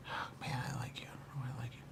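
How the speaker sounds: soft unvoiced whisper. Real, not acted. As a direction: breathe it out as a private confession. Intimate aside in session.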